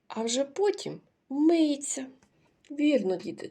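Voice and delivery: normal talking voice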